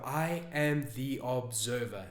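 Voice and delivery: slow, soft, reflective reading. Shown as a demonstration. Coach shows viewers how to reread a journal entry, very slowly. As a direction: take it slowly, softly, letting each word sink in. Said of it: "and you do it slowly".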